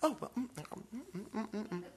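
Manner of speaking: soft, high humming of fake politeness; polite forced murmur. Adult politely pretending to like the food, soft hum. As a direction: hum along, pretending to enjoy it. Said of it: "they're saying".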